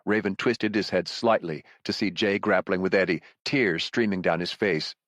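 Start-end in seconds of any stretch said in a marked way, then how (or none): none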